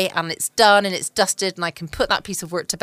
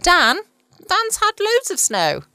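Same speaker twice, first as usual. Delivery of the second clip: higher, playful sing-song about Dan; mock-excited Dan; 13 semitones higher, 4 dB louder, slower than usual. Real, not acted. Host teasing her friend Dan about snow, playful high sing-song. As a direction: tease Dan with a bright lilt.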